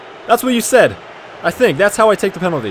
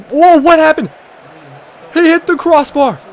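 high, loud disbelief; shocked; 9 semitones higher, 5 dB louder than usual. Real, not acted. Gamer stunned as the shot hits the bar, loud. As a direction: react to the crossbar in shock.

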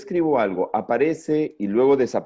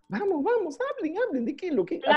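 high coaxing call to a class. Real, not acted. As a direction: cheer them on in a high voice. Teacher coaxing kids to answer.

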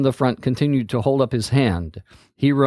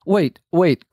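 higher, louder call to stop; urgent boy calling wait; crying out; 6 semitones higher, 4 dB louder than usual. A narrator's reading. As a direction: call after her to wait. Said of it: "cried Hero".

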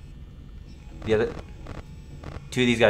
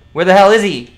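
frustrated yell; 7 semitones higher, 9 dB louder, faster than usual. Real, not acted. Hunting for the duck, frustrated.

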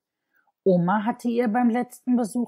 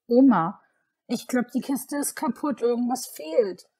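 dismayed call; 6 semitones higher, 3 dB louder than usual. Acted. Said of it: "rief sie entsetzt".

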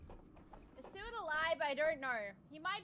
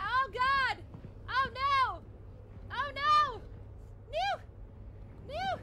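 high loud panicked cries; panicked screaming; 8 semitones higher, 5 dB louder, much slower than usual. Real, not acted. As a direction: scream in real terror. Chased by monster.

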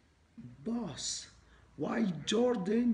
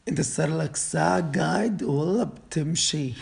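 angry yell; 3 semitones higher, 12 dB louder than usual. Acted. Boss shouts in disbelief.

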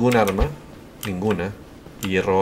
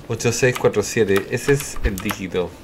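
normal talking voice

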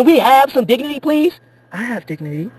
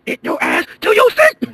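much higher shouted command; yelled order; 13 semitones higher than usual. Acted. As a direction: bark him back to his seat. Pilot yelling at passenger.

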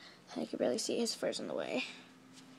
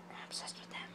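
whisper; 20 dB quieter, much faster than usual. Real, not acted. Whispered aside.

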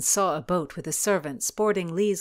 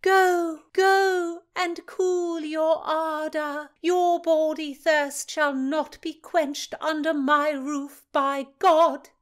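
high, loud, ringing like a trumpet; commanding banishment; 11 semitones higher, 7 dB louder, slower than usual. A narrator's reading. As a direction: cast him out of the house. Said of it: "her voice the final trumpet".

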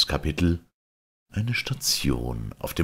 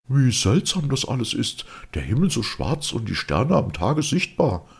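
raised voice of the astonished earthman; Fridolin marveling; 9 semitones higher than usual. A narrator's reading. (staunender Fridolin, höhere Stimme)

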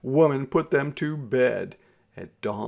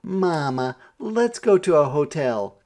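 put-on child's eager plea, higher and louder; crying out; 5 semitones higher, 6 dB louder than usual. A narrator's reading. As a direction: call out eagerly to Mama. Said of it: "Clara cried".

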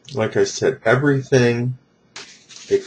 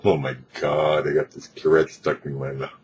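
mild panic; 5 semitones lower, 6 dB quieter than usual. Retold or imitated. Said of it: "I still have my legs going".

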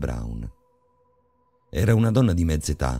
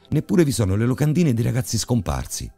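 fired up; 8 semitones higher, 3 dB louder than usual. Acted. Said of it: "risposi infervorato".

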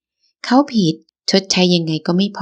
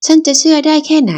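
much higher, louder outraged cry; furious shout; 10 semitones higher, 4 dB louder than usual. Acted. Wife shouting at her husband in disbelief, furious. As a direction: blast the question at him.

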